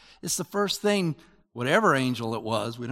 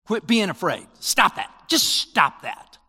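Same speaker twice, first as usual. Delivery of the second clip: loud, higher mock scolding; mock stern command; 5 semitones higher, 7 dB louder than usual. Preacher mimics a harsh God, mock stern and loud. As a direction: bark it as the God he is not. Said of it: "God's not just saying".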